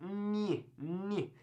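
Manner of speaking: "ni" as a soft, isolated nyih; N Y sound. Shown as a demonstration. Teacher isolates the ñ, soft N Y sound. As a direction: hold "ni" as one ny sound. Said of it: "So it's kinda like".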